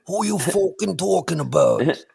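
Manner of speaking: high, loud Conor McGregor snarl; mock a brash Irish fighter; Irish accent. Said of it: "He'll be riding around in his Bentley like".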